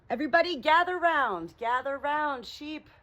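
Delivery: high, loud call to gather everyone round. Real, not acted. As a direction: call the flock over. Calling the sheep to gather.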